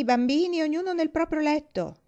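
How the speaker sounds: loud high call, rising on bambini. A narrator's reading. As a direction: summon the kids to their beds. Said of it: "chiamò missis Parker". (richiamo acuto e forte)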